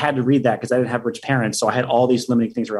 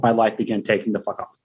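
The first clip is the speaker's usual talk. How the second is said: normal talking voice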